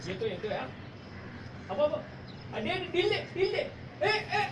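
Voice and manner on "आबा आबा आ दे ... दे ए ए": urgent alarm. Acted. Kite being cut, urgent calls.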